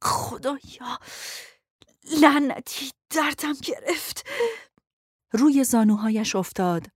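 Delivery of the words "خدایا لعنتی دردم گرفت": breathless, hurting moan; moaning in pain. A narrator's reading. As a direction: curse through the pain, out of breath. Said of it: "از شدت درد مینالید".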